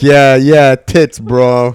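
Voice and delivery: tag. Pretend. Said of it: "I'm describing".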